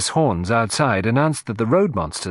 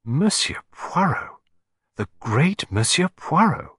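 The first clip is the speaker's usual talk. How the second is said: reverent awe; 6 semitones higher, slower than usual. A narrator's reading. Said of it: "obvious reverence in her voice".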